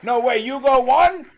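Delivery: high, loud disbelieving shriek; shrieking jackpot winner. Real, not acted. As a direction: shriek in amazement.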